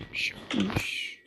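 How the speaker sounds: slow hushed whisper; barely voiced, hiding. Real, not acted.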